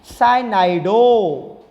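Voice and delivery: साइनाइडो slowly with a long final o; pronunciation demo. Shown as a demonstration. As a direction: stretch the ending of साइनाइडो to 'dohh'. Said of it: "इसकी pronunciation क्या होगी". (साइनाइडो का धीमा उच्चारण, लंबा 'डो')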